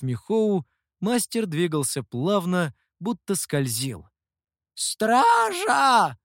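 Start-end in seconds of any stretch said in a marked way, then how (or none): 4.7-6.2 high, loud call for the guards; high-pitched, alarmed cry; old man's shout. A narrator's reading. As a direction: yell for the guards, stretched out. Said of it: "кричал старик".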